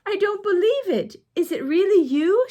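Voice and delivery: high, stunned and incredulous; can't believe who's in front of her; gasping disbelief, voice leaping up. A narrator's reading. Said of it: "she asked in disbelief".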